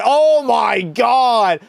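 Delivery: high, drawn-out cry of disbelief; shocked outcry. Real, not acted. Streamer seeing the goalie get run over, shocked outcry. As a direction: stretch it out high in real shock. Reacts live to a goalie collision.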